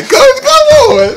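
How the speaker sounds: high-pitched, amazed exclamation; excited outburst. Real, not acted. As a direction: cry out in disbelief at the playing. Amazed reaction to guitar playing.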